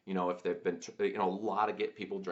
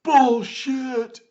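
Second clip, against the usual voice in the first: a much higher, much louder heckler's yell; retold yell; 16 dB louder than usual. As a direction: bellow it out at the stage like a drunk heckler. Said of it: "this one guy at this table just yelled out".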